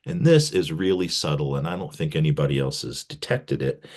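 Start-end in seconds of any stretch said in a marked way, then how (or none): none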